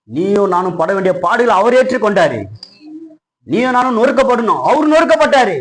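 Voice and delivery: rising, emphatic preacher's cry; impassioned preaching. Acted. Preaches at full force.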